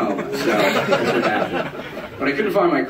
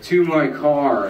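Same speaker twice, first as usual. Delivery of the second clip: put-on slow drunk; 2 semitones higher, much slower than usual. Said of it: "I was like".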